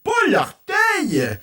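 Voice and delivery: high, loud pained complaint; hurt dragon. Acted. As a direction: whine loud and high about the toe. Dragon holding his hurt toe.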